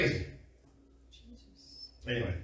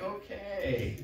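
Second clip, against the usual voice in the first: tag; 4 semitones higher, 8 dB quieter than usual. Pretend. Reaction to the acted squabble.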